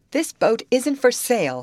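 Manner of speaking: ordinary delivery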